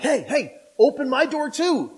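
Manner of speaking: high mock plea to be let out; begging prisoner. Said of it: "other prisoners are like".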